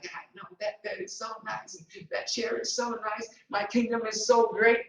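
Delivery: a hint of vain mirror-gazer, softly; hushed self-admiration. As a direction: breathe it like you're in awe of yourself. Admiring himself in mirror, quoted.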